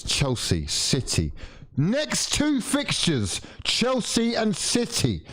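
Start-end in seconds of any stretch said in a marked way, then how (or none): 1.8-5.2 high, slow disbelief; aghast. Real, not acted. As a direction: let the dread rise. Dread at fixture list.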